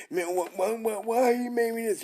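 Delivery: high, stammering mock complaint; mocking whiner. Preacher mocking doubters who question their maker, whiny and high. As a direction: mimic a whining complainer, pitch climbing. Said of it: "Can, can they say".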